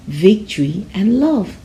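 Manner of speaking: ordinary delivery, nothing marked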